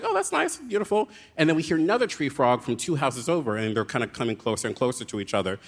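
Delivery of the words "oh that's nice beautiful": dreamy delight. Retold or imitated. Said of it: "I was like".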